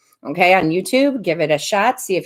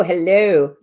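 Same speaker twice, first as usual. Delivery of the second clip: usual speaking voice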